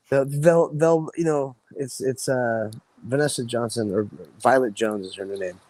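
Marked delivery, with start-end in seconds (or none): none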